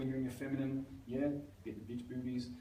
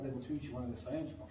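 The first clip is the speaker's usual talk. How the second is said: ordinary delivery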